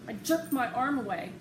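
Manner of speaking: ordinary delivery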